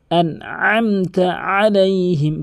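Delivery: "আনআমতা" slow with a squeezed ayn; correct throaty ayn. Shown as a demonstration. Teacher saying practise it this way, slow careful recitation. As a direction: stretch "আনআমতা আলাইহিম", throaty on both ayns. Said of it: "এভাবে".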